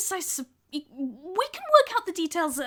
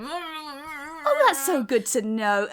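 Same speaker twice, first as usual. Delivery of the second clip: Frankie's voice; 3 semitones higher, slower than usual. Acted. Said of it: "just be like".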